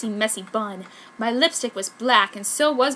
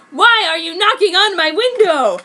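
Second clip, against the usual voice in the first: high, loud angry demand; angry yell; 5 semitones higher, 8 dB louder than usual. A narrator's reading. Heroine yells at Aoyama knocking on her window, angry. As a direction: keep yelling at him. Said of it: "yelled with rage".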